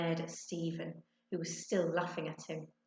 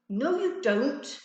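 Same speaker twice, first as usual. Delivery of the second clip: slow, loud, higher denial; arguing; 7 semitones higher, 8 dB louder, slower than usual. A narrator's reading. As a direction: argue back hard. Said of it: "Ben argued".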